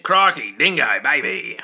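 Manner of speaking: hammed-up Australian accent, light; a quick jokey Australian accent. Streamer asked by chat for an Australian accent, light Australian accent. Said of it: "Do an Australian accent".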